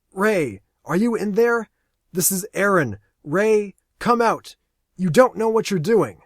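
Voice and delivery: loud, high pleading call; urgent shout. Acted. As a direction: shout to stop him. Aaron pleads over radio.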